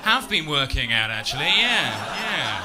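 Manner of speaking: loud, drawn-out, flirty boast; slow, self-satisfied showing off; smug preening.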